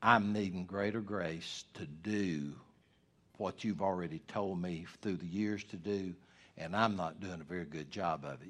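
quiet, humble confession to God; humble prayer. Pretend. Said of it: "say to the Lord".

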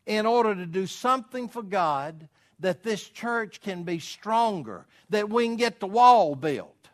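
rally the church to sacrifice; full-force preaching. Acted. Pastor urging the church to give for the wall, full force.

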